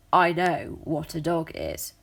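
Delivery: ordinary delivery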